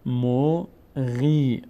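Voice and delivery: each syllable drawn out, pause between; slow syllable-by-syllable reading. Shown as a demonstration. Teacher models exercise words for students, slow syllable-by-syllable reading. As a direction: sound it out slowly for learners to repeat.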